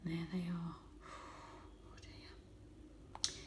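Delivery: fearful hushed aside; whisper. Real, not acted. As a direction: whisper as if they could hear. Reader aside, scary picture.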